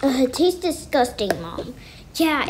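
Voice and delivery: ordinary delivery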